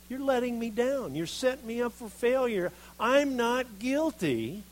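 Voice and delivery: loud, high, accusing rant at God; Jeremiah's complaint. Acted. Jeremiah accusing God of failing him, loud and indignant. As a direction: hurl the blame upward, indignant.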